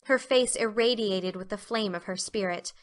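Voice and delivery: ordinary delivery, nothing marked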